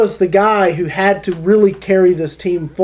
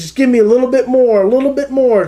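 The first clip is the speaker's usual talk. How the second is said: higher, urgent coaching push; encouraging coach; 5 semitones higher than usual. Retold or imitated. Coach blindfolded crawling player, higher urging. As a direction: coach urging a tired player to dig deeper. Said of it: "encouraging this player to just dig deeper".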